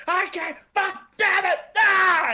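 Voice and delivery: high, loud hammed-up frustrated shout; Steiner raging. Steiner raging while pummeling Benoit, mock shout. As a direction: rage like a furious wrestler. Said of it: "He's like".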